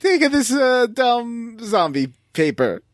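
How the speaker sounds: high, hammed-up Italian Mario accent, slowing at the end; high mock Italian plumber voice dragging out the last words. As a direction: tease the kid in a cartoon Italian accent. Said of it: "in a Mario accent".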